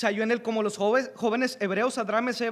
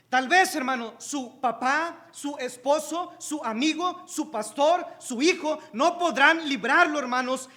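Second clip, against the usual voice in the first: higher, louder, rising fervour; fiery sermon, voice climbing; preaching at full force; 4 semitones higher, 5 dB louder than usual. Acted. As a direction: build the congregation up with each line.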